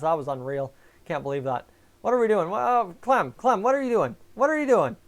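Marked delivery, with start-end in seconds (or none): 2.0-5.0 exasperated. Real, not acted. Calling out to a misbehaving chatter.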